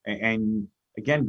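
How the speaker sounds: usual speaking voice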